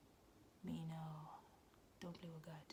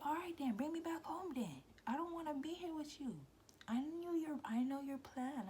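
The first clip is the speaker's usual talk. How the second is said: high, raised-voice retort; angry demand; 10 semitones higher, 5 dB louder than usual. Retold or imitated. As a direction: argue back hotly in a high pitch. Retold quarrel, demands ride home.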